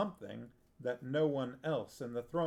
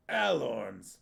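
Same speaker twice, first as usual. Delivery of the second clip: spat out; 5 dB louder than usual. A narrator's reading. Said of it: "Natchak spat".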